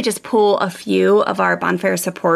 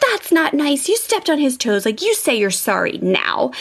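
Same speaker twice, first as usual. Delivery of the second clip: higher-pitched imitation of a telling-off; mom scolding; 5 semitones higher than usual. Mom ordering older boy to apologize, mock scolding. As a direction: scold a child, pitched up at first.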